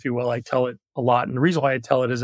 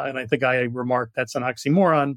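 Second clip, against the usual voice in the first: ordinary delivery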